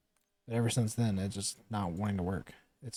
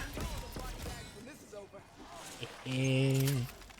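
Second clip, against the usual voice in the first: evil laugh; 8 semitones higher than usual. Pretend. Villain laugh after proclamation.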